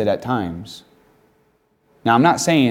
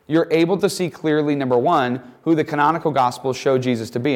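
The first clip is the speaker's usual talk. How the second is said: ordinary talk, nothing marked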